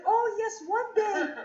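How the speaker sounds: mock Russian-accented afterthought; Russian mother. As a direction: recall it casually as a Russian mother. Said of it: "And then she says to me".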